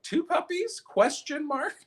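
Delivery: put-on high, puzzled old mom reading her post; hammed-up high mom voice; mock mom voice. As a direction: squeak it out like a confused mother. Mom's Facebook comment voiced.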